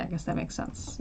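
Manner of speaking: a reluctant half-whisper of surrender; grudging whispered agreement. Retold or imitated. Quoting people who finally concede her point, in a grudging whisper. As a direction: breathe it out, barely voiced, grudgingly conceding.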